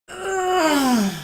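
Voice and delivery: long strained groan, high and loud; high whiny groan. Real, not acted. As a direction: groan out the tiredness. Tired groan before break.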